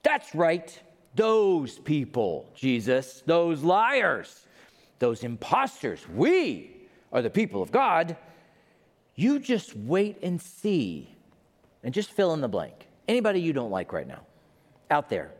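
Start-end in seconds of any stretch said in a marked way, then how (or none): 0.0-11.2 mock preachy gloating, drawn-out words; hammed-up holier-than-thou condemnation, stretching the words; self-righteous. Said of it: "what I think is".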